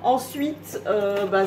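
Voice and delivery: ordinary talk, nothing marked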